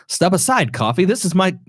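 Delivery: a hint of old Hollywood transatlantic; Cary Grant transatlantic. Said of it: "So Cary Grant would say it like this".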